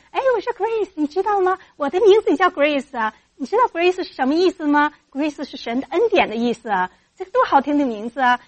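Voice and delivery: put-on high, loud, lively talk to a child; enthusiastic encouragement. Auntie tells shy girl about her name, high enthusiastic. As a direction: build a shy kid up with animated delight. 然后我跟他说.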